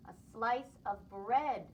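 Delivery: slow, deliberate words for Buddy; slow patient repeat. Acted. Mother repeating to Buddy, slow and patient. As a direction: spell it out slowly.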